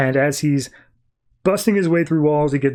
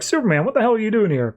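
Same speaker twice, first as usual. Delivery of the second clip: surprised call; 8 semitones higher, faster than usual. Retold or imitated. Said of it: "calls out from the side".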